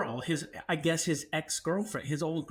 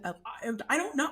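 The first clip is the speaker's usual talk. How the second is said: higher, exasperated I don't know; frustrated; 9 semitones higher than usual. Retold or imitated. Pressed by roommate, getting frustrated. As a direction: push back, fed up. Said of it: "I started getting frustrated".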